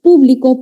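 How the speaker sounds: PÚ-bli-co, the noun; stress on first syllable. Shown as a demonstration. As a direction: hit the first syllable of "Público". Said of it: "cambiándole la ubicación del acento".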